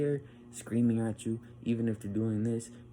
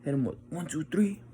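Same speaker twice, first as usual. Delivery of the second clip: usual speaking voice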